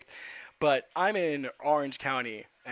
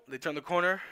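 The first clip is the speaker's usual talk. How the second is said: ordinary talk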